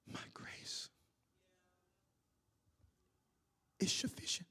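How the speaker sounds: breathy low whisper of God's answer. Acted. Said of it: "God responds with the same thing".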